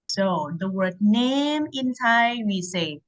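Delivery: normal talking voice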